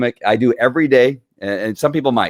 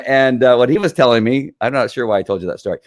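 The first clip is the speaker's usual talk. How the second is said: ordinary talk, nothing marked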